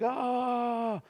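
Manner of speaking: high, overwhelmed little Oh; touched exclamation. Retold or imitated. Soldier parent moved by the child's greeting, high overwhelmed oh. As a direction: gasp it out like it's melting your heart.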